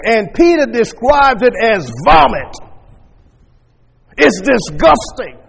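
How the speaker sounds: loud, emphatic pulpit delivery; forceful preaching. Real, not acted. Preacher warning of Christians gone back to the world, forceful. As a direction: drive it home with hard, loud stresses.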